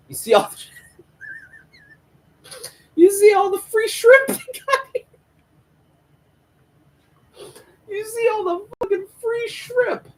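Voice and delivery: very high, loud tearful wail; mock crying. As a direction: wail it through exaggerated sobs. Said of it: "crying in the back".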